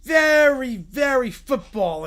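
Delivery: drawn-out football-guy emphasis. Acted. Describing the football voice to listeners, slow and emphatic. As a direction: stretch it out like a sports personality. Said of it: "My football voice is".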